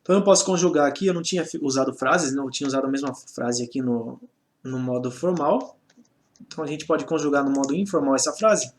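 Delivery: usual speaking voice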